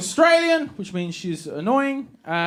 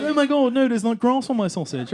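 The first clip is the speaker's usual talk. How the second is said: fussy hipster; 6 semitones higher than usual. Retold or imitated. Mimics fussy hipsters at barbecue.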